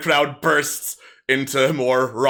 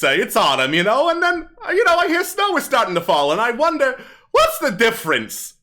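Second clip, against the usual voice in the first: higher, livelier stage patter; kobold comedian; 13 semitones higher, 6 dB louder than usual. Acted. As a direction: work the crowd like a hammy stand-up comic. Kobold tells joke.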